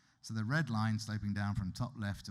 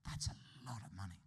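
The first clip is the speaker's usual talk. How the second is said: hushed, breathy aside to the room; whispered aside; 7 dB quieter than usual. Real, not acted. Lecturer whispering an aside to his audience about the sum, hushed. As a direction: drop to a whisper to let the number sink in. Economist's aside after GDP figure.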